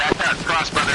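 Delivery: loud urgent yell; shouting orders over battle. Real, not acted. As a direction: push the team across, shouting. Squad crossing under fire.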